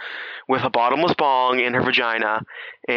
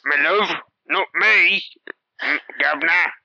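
a higher, hammed-up Cockney voice; mock Cockney; 5 semitones higher than usual. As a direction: play the president in a jokey Cockney accent. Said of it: "people that have Cockney accents".

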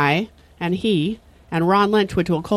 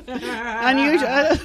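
slow drawn-out deadpan; 3 semitones higher, much slower than usual. Acted. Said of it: "Nice delivery".

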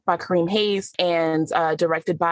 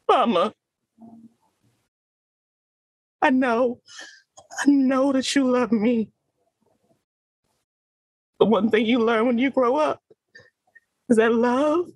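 heightened, pitched-up acting voice that swells and breaks; stage monologue, raw and emotional; dramatic monologue; 6 semitones higher than usual. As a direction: play a hurt daughter talking to Mama. Performed acting monologue on mic.

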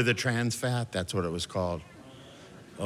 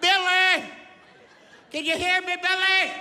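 high, loud call to wake him; 5 dB louder than usual. Acted. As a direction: shout his name to rouse him. Calling coma patient awake.

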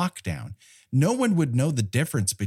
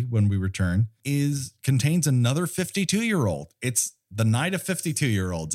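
ordinary talk, nothing marked

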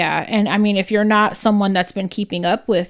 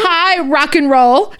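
put-on high, drawn-out toddler hello; little kid greeting; 5 semitones higher, 4 dB louder, slower than usual. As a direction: sing out slowly to a stranger like a toddler. Toddler greeting the man by nickname.

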